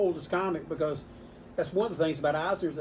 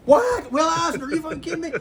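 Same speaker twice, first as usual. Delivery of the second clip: loud, high-pitched outburst of disbelief; imitated shock; 12 semitones higher, 9 dB louder than usual. Customer hears the caller was Will Eisner, shouted astonishment. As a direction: explode as the flabbergasted fan. Acts customer stunned by famous name.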